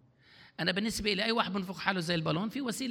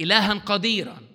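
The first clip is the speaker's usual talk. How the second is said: إعلان بقوة; 4 semitones higher, 7 dB louder than usual. Retold or imitated. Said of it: "قال".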